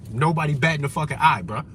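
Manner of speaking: ordinary talk, nothing marked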